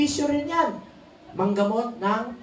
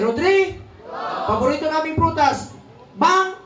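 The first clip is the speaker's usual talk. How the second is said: shouted answers; 6 semitones higher than usual. Real, not acted. Senator quizzing the rally crowd, shouting answers. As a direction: punch each answer out to the crowd.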